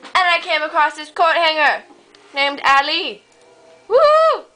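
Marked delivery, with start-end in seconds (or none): 3.9-4.5 a high, loud whoop; whooping with glee at meeting Ali; excited whoop. Acted. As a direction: let out a sudden high, loud cheer. Excited cry meeting coat hanger.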